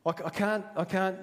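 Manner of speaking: high, halting protest; acted tongue-tied reluctance; stammering excuse. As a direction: falter and restart as you make excuses. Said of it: "he stammers and, and, and stutters".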